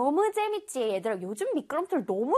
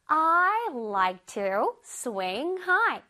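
slow example read; 2 semitones higher, much slower than usual. Shown as a demonstration. Teacher reading example to children, very slow. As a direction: spell out the sentence slowly for kids. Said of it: "자, 읽어볼게요".

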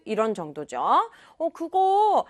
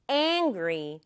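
"angry" stressed on the opening A; stress on first syllable; 5 semitones lower than usual. Shown as a demonstration. Teacher modelling for children to repeat, stress on the A of "angry". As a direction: lean on the A of "angry", letting the rest fall low. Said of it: "아 부분이 강조네요".

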